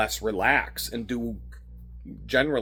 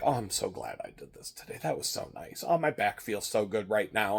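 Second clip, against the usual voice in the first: relaxed and content; 4 semitones lower, 8 dB quieter than usual. Retold or imitated. Relaxed at end of yoga.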